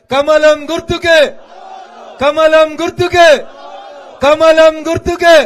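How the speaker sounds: slow, loud rally chant, each word drawn out. Real, not acted. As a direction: bellow the slogan slowly to rouse the crowd. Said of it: "జర గట్టిగా చెప్పాలి".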